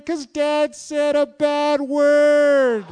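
put-on slow, high, loud child's whine; imitating crying child. Pastor imitating his daughter tattling on Dad, slow and whiny. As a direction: whine it out slowly like a tearful little girl. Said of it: "And Kaylin is crying".

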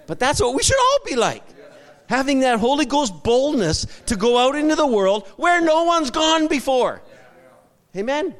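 high, loud preacher's exhortation; impassioned, ringing sermon delivery; fervent preaching. Real, not acted. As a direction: preach it at full force, rousing the congregation. Preaches at full force.

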